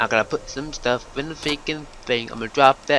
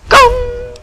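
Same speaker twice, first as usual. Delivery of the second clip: yelling; 11 dB louder than usual. Real, not acted. Yelled high at key moment.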